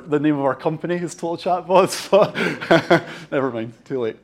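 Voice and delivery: usual speaking voice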